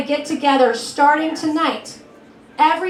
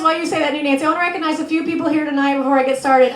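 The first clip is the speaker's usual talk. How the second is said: normal talking voice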